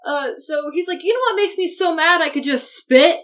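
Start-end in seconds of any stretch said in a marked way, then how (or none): 1.0-3.2 high, loud, exaggerated indignation; mock fuming. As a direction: act out a worked-up teen seething. Quotes Jacob's angry line.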